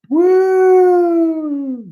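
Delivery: long, high cheering woo; celebratory falsetto whoop; loud whoop. Real, not acted. Cheering the winner.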